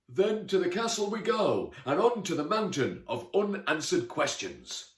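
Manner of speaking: high, loud storybook knight's declaration; heroic knight, rallying. Acted. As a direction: proclaim the quest grandly.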